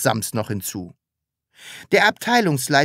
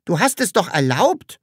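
higher, innocently surprised question; puzzled; 6 semitones higher, 3 dB louder than usual. A narrator's reading. Sams reminds the boss of permission, puzzled. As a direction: wonder at it in a high voice. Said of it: "wunderte sich das Sams".

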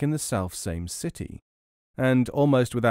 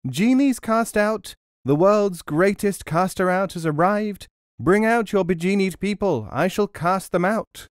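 calling out; 7 semitones higher, 4 dB louder than usual. A narrator's reading. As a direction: hawk it to the whole market square. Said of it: "began calling out".

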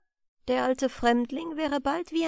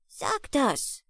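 put-on little boy pleading, higher voice; urgent plea; 5 semitones higher than usual. A narrator's reading. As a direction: plead urgently in a small high voice. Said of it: "bat eindringlich das Büblein". (eindringlich bittendes Büblein)

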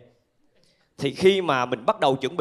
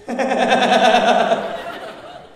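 audience roaring with laughter; big laugh from the crowd; 6 semitones higher, 5 dB louder than usual. Real, not acted. Stand-up crowd laughs loudly. (khán giả cười ồ)

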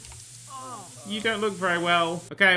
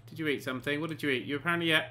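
usual speaking voice

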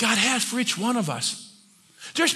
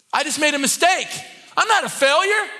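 defiant declaration; 6 semitones higher than usual. Acted. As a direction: cry it out as a defiant vow. Declaring victory over a lie.

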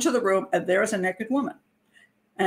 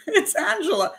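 high, disbelieving "It's Angela"; high astonished realization; incredulous; 10 semitones higher than usual. Retold or imitated. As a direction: say it amazed and resigned. Disbelieving recognition.

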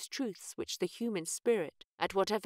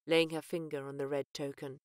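ordinary delivery

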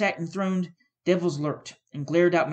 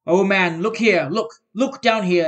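loud, urgent ghostly cry; loud exclamation; 2 semitones higher, 9 dB louder, slower than usual. A narrator's reading. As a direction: cry out to make him look. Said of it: "exclaimed the ghost".

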